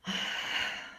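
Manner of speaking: long audible exhale; unhurried sigh out. Real, not acted. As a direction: let out a breathy, voiceless sigh. Pause while settling into the talk.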